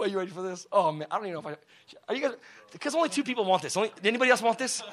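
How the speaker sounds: ordinary delivery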